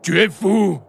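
high-pitched exclamation of disbelief; father's high, outraged cry. A narrator's reading. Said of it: "s'écria Marco Pedro".

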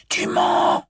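high, unvoiced furious hiss; furious hissed accusation. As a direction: spit the accusation in rage. Voldemort accuses the old man.

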